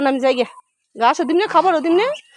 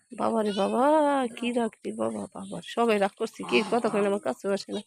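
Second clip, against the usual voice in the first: low, soft muttering to herself; quiet aside; 8 semitones lower, 9 dB quieter than usual. Real, not acted. To herself about the sulking cow, soft mutter. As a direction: mutter the complaint under your breath.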